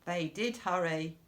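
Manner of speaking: plain reading voice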